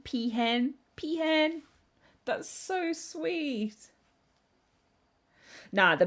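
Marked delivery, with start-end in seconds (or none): 0.0-0.7 Peahen with a questioning rise; rising peahen. Real, not acted. Streamer tries Peahen as her name, rising. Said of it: "I want that to be like my name". 1.0-1.6 Peahen squealed much higher, falling. Real, not acted. Streamer repeats Peahen as her name, squealing. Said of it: "I want that to be like my name". 2.3-3.9 much higher, slow, gushing coo; delighted cooing. Real, not acted. Streamer falls for the word peahen, cooing high.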